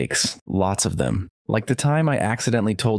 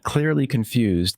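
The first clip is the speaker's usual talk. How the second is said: ordinary delivery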